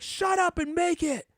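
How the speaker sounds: high-pitched mock angry demand; upset kid. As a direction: imitate a child throwing a tantrum. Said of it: "you don't have to be so upset".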